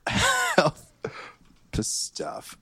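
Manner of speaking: high then hushed repeat; mock solemn echo. Player solemnly repeats the DM's phrase, high then hushed. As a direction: start high and let it fade to a breath. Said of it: "Okay, I wrote it down".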